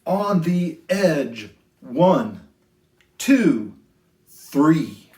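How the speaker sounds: sing-song rhyming storybook read; lilting picture-book voice; reading to children. A narrator's reading. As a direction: read it gently to a class of little kids.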